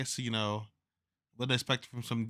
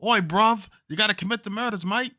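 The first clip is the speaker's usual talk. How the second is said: high-pitched, hammed-up British lad; mock British accent; 9 semitones higher, 4 dB louder than usual. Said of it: "whispering in a British accent".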